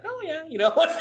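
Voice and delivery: very high, laughing impression of a wife; mock cheery wife. Wife happy she just murdered her husband, mock cheery.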